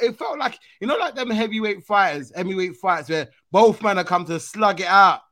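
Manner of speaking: ordinary talk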